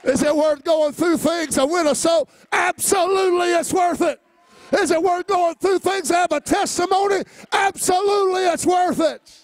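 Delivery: high, loud shouted preaching; rising fiery sermon shout; preaching at full force. Acted. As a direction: preach it out to the whole church. Preacher rhetorical questions, climax.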